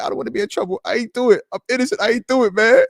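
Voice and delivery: high, hammed-up pleading; mock plea of innocence. Pleading innocence to Steelers Nation, high and mock-nervous.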